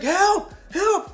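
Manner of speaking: high mock-panicked cries; imitated freaking out. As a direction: squeal for help as the scared dad. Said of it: "Freaking out".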